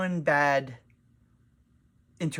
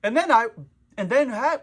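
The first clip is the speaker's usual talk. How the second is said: higher, louder choppy video-intro voice; mock YouTuber; 7 semitones higher, 6 dB louder than usual. Vlogger mocks heavily edited YouTube videos, imitating a choppy YouTuber. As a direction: imitate jump-cut YouTube talk, breaking off each time. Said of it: "I see these YouTube videos like".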